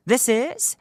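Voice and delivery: high, loud, very drawn-out show title; slow, sustained chant-like announcement; theatrical title call. Pretend. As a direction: intone the title like a summoning. Theatrical show title after spell.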